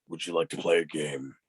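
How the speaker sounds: low, ominous villain invitation; Jigsaw. Acted. Guest jokingly answers as a film villain, deep ominous voice. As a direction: drop the voice deep for a horror villain. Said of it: "I'm not Jigsaw, but".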